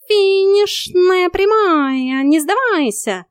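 high, loud, drawn-out rallying cry; stretched commentator-like urging; cheering cat coach. A narrator's reading. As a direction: cheer them to the finish.

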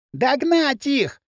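high, slow, drawn-out command; shrieked order. A narrator's reading. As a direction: squeal the order, stretching it. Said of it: "раздался визг Пакли". (протяжный визгливый приказ)

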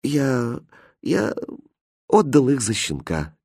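soft, halting, fearful confession; frightened babble. A narrator's reading. Aladdin confessing to his angry mother, frightened stammer. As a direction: falter on the first words, barely daring to answer. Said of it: "испуганно пролепетал Аладдин".

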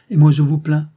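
proud, pitying rebuff; proud reply. A narrator's reading. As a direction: answer firmly with recovered pride. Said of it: "en revenant à sa fière nature".

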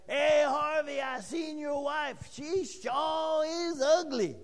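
high, drawn-out mock shout; calling out. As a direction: holler it like a teasing song line, stretching the end. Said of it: "it goes on and it says".

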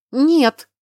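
loud, high, emphatic no; flatly refuse, at full voice; categorical refusal. A narrator's reading. Pregnant girl refuses marriage, categorically. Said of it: "категорично ответила она".

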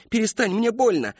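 high, loud cry to stop; girl cries out in pain; pained plea. A narrator's reading. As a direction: beg him to let go. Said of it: "вскрикнула Мэри Кэтрин".

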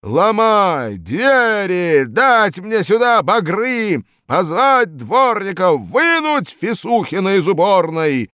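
high, loud, slow bellowed commands; loud furious slow commands; raging orders. Acted. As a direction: bark orders to break in. Boss orders door broken.

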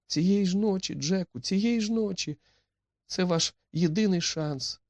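high-pitched, urgent pleading; frightened young woman. A narrator's reading. Etti begs Jack to flee tonight, high and urgent. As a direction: play the scared heroine, voice held very high. Narrator voices Etti urging escape.

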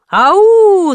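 loud, high, drawn-out hoot; crowd hooting. A narrator's reading. A waiting crowd hooting at the author, loud and drawn out. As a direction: call it out long, rising high. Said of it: "загудела толпа".

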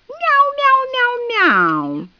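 very high cat imitation; mocking meow. A narrator's reading. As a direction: meow at her like a taunting cat. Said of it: "замяукала нимфа". (насмешливое мяуканье)

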